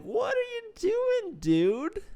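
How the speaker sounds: high, mock-incredulous squeal at the game; squeaky exasperated quote of himself. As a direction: whine the question up high in disbelief. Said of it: "And it's like".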